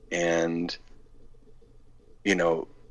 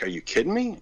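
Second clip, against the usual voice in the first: higher, incredulous are you kidding me; retold disbelief; 10 semitones higher than usual. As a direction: sound floored by the plan.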